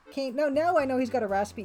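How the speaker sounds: normal talking voice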